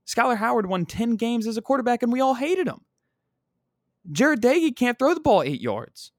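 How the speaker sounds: high-pitched, incredulous exasperation; exasperated rant. Real, not acted. Fan ranting about his team's quarterbacks, exasperated.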